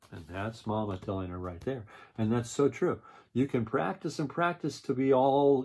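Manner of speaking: ordinary delivery, nothing marked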